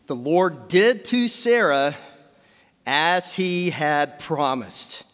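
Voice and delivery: slow, measured verse with long pauses; solemn scripture reading. Acted. Preacher reading Genesis to the congregation, slow solemn reading. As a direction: read it out phrase by phrase, weightily.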